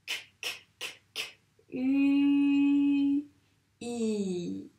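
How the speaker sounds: "K, k, k, k, U, I" as clicked k's, then long held vowels; sounding out letters. Shown as a demonstration. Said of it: "bien dire tous les sons".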